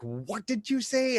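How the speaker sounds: high, drawn-out disbelieving question; mock a mother's shocked double take; appalled mom. Mom hearing the speaker say water, appalled disbelief. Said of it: "the first time my mom heard me".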